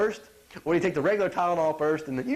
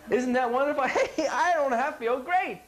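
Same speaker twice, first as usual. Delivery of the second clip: mock delight; 7 semitones higher than usual. Mocking aspirin users' relief.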